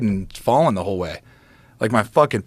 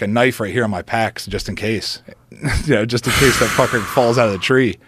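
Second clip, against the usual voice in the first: ordinary talk, nothing marked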